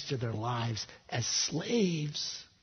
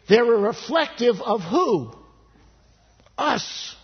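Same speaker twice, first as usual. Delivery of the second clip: louder, higher preacher's call; full-force preaching; 11 semitones higher, 10 dB louder than usual. Acted. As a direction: preach it hard to the congregation.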